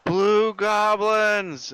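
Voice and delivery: long, loud, high battle-style call; shout. Acted. Player shouts in orc to distract the orcs, drawn-out shout. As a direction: bellow it out slowly. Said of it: "and I shout in orc".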